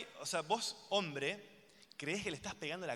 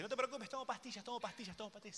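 woman reassuring; 6 semitones higher, 8 dB quieter than usual. Retold or imitated. La loca que te dice.

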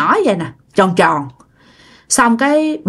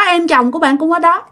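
ordinary delivery

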